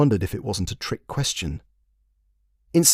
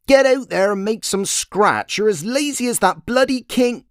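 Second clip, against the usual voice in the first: scolding fishwife; 12 semitones higher, 3 dB louder than usual. Acted. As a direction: nag him down the street, high and loud. Wife hurls husband out, scolding.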